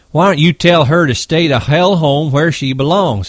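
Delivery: taunting. Acted. Carlson taunting Curly.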